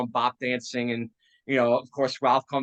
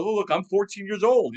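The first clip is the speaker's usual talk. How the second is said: mocking; 7 semitones higher, 4 dB louder than usual. Mocking Alice in retold scene.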